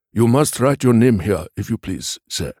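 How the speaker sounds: post office man's polite instruction; polite messenger. A narrator's reading. Post office man asking for a signature, polite suggestion. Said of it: "he suggested".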